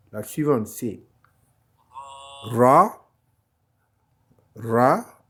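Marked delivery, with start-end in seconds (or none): 2.4-5.2 "Ra" rolled, said louder; "Ra" with a tapped R; "Ra", le R roulé; Arabic letter Ra. Shown as a demonstration. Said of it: "le R, Ra".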